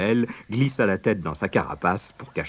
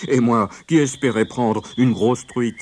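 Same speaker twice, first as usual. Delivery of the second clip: louder, lightly woeful lament; sighing wolf; 4 semitones higher, 6 dB louder than usual. A narrator's reading. Wolf regretting his lost trout, sighing lightly. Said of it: "soupira Père Loup".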